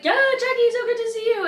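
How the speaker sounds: high, loud, gushing hello; excited greeting. Retold or imitated. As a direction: greet an old friend with a big lift. Guy greets her excitedly.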